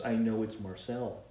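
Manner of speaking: ordinary delivery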